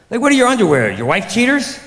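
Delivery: high, loud mock disbelief; incredulous questioning. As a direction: demand an answer, scandalized. Incredulous questioning about garment names.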